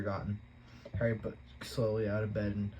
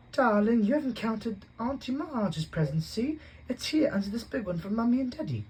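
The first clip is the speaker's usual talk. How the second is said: Aunt Petunia coaxing; 12 semitones higher, 5 dB louder than usual. A narrator's reading. Mother soothes spoiled son.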